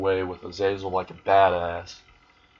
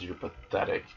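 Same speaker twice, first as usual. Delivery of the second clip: a whispered, scornful put-down; contemptuous whisper; 7 dB quieter than usual. Acted. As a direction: breathe it out with cold contempt. Issei sneers at broken Rias.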